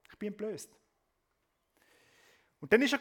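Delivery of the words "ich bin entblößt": much softer, shamed inner voice; exposed, hushed. Acted. Preacher voicing Moses' shame, hushed. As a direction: let it sink to a hush. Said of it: "Das Gefühl von der Scham".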